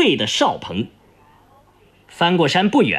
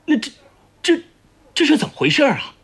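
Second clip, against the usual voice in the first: stammering, then a quick higher question; baffled; 8 semitones higher, much faster than usual. Acted. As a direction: stumble over the first words in bewilderment. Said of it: "倒像是丈二金刚摸不着头脑". (困惑地结巴发问)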